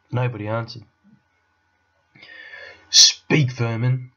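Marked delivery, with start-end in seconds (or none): 2.9-4.1 fierce witch's command; imperious witch command. A narrator's reading. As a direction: spit the order out as an angry witch. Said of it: "she said again".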